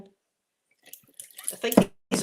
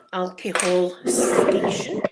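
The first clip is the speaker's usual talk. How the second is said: slow announcement; 2 semitones lower, 7 dB louder, much slower than usual. Acted. Announcing the drinks spot.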